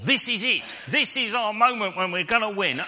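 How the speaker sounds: high-pitched, eager anticipation; excited thought. Retold or imitated. Acted team's excited thought at quiz.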